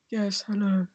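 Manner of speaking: deliberately underplay against a shouting partner; very quiet reply. Retold or imitated. Answering a shouting scene partner in the spoof, very soft. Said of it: "really, really quietly".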